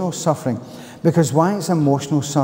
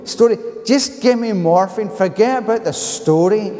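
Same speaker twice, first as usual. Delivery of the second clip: furious Glaswegian patient demanding morphine; broad Glasgow accent; 8 semitones higher than usual. Retold or imitated. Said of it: "in broad Glasgow accent".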